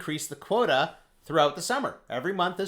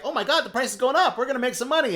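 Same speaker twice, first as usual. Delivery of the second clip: excited buyers; 11 semitones higher, 5 dB louder, faster than usual. Retold or imitated. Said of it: "other people are like".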